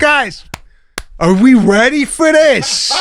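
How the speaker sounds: slow, high, hammed-up game show hype; milk the moment like a warm-up act; hype announcer. Imagining a lottery host hyping the draw, hammed-up.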